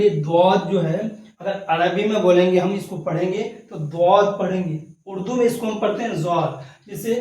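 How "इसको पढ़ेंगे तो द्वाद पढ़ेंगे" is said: ordinary talk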